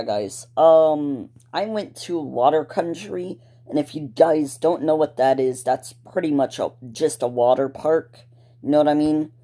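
normal talking voice

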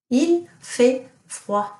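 Il fait froid repeated slowly; teacher repeating Il fait froid for pupils; slow model sentence. Shown as a demonstration. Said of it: "what does it mean if I say".

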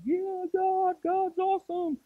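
pumped-up cheer. Retold or imitated. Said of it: "and you're like".

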